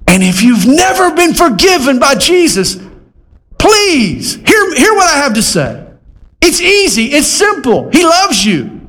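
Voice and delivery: high-pitched, pleading preaching; impassioned altar call. Acted. Preacher urging those never forgiven by Jesus, impassioned and high.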